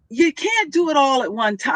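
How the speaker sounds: usual speaking voice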